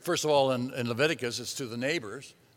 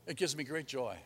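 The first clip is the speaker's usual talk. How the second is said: soft, lower, savouring great joy; hushed, tender repeat; 3 semitones lower, 12 dB quieter than usual. Acted. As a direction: let the words sink in quietly. Savours the phrase quietly.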